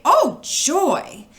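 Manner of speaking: delighted. A narrator's reading. Story exclaims delight.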